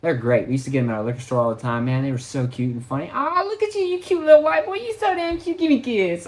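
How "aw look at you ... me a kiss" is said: very high, cooing fake voice; fawning lady voice. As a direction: coo it way up high like a doting auntie. Ladies fawning over him.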